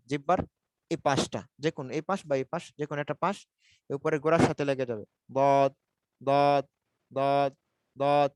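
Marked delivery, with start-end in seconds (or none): 5.3-8.3 "দদ" drawn out from one side of the tongue; heavy, held "দদ", tongue edge on upper molars; side-of-tongue articulation. Shown as a demonstration. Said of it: "আমি একটা পাশ থেকে উচ্চারণ করতেছি". (জিহ্বার এক পাশ থেকে টেনে "দদ" উচ্চারণ)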